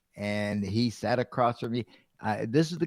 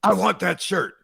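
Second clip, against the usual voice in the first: a hint of a higher demanding boss; 6 semitones higher, 4 dB louder than usual. Boss at his door in the morning, mild demanding voice. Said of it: "He goes".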